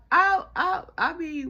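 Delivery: high, loud words choked by laughter; laughing. Real, not acted. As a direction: barely get words out laughing. Still laughing after story.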